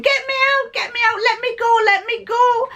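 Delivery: high-pitched retold plea, same loudness; screaming to be let go. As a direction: mimic a frightened woman pleading to escape. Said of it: "she was screaming".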